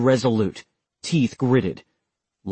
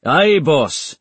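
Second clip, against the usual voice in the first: deadpan; 5 semitones higher, 5 dB louder than usual. A narrator's reading.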